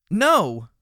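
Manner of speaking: loud, high, defiant shout. Acted. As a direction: yell it back, high and loud. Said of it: "Glory shouted back".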